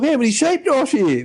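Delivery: very high-pitched impression of an overeager lad; frothing keen kid. Novice asks mentor about his first heat, high frothing-kid impression. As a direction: imitate an excited kid, voice pitched right up. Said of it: "he's just a frother".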